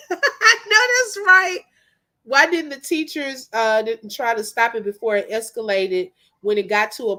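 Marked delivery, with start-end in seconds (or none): 0.1-1.6 high, loud laughing agreement. Real, not acted. Vlogger agreeing with a viewer's comment, laughing. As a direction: crack up while agreeing, drawn out. Amused reply to chat comment.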